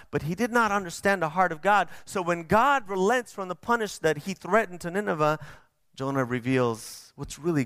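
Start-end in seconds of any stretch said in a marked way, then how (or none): none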